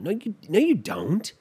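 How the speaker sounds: normal talking voice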